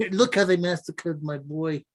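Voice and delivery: put-on grieving mob boss voice; mock-mournful film impression; Godfather. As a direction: lament like an old movie don. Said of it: "have [name] do The Godfather".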